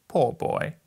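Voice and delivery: pitying. A narrator's reading. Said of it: "he said in pity for his former self".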